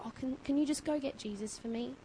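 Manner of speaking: soft, casual mock request; normal voice, understated. Preacher mimicking a timid request Bartimaeus didn't make, soft normal voice. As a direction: ask quietly, as a small everyday favour. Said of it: "just speak in a normal voice and go".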